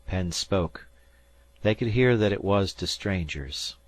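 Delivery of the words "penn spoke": ordinary delivery, nothing marked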